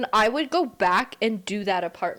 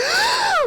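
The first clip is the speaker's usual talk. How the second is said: loud, high gasp of alarm; sharp mock-panicked gasp; startled gasp; 5 dB louder than usual. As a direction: imitate a mom jolted awake. Mom woken from a nap.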